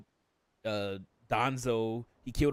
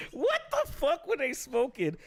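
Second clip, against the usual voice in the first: much higher, slow, baffled; incredulous; 13 semitones higher than usual. Retold or imitated. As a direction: stretch it out in total disbelief. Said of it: "And I'm just like".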